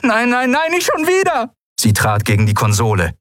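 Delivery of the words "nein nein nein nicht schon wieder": desperate outburst. Acted. Despair as power fails.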